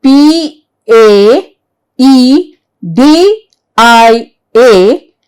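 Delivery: slow, separate letters, each clearly spaced; spelling letter by letter. Shown as a demonstration. Teacher spelling pediatrician slowly for students. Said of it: "Let us see the spelling".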